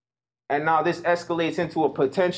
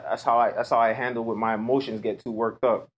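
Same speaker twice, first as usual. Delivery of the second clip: usual speaking voice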